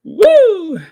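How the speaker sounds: high, loud whoop after laughter. Real, not acted. Getting it out of her system, loud whoop. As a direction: whoop to get it out.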